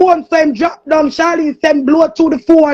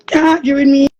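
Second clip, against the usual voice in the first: ordinary talk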